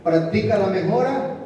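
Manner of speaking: loud, driving sermon delivery; rallying the congregation at full voice; fiery preaching. Acted. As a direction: preach it out to the whole church.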